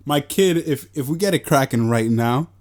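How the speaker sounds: ordinary talk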